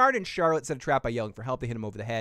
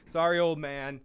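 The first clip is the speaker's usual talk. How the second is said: ordinary talk, nothing marked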